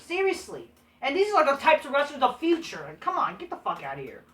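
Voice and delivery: ordinary delivery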